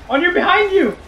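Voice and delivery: high-pitched urgent callout; frantic warning. Real, not acted. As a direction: cry out a warning to a teammate. Frantic warning to teammates in combat.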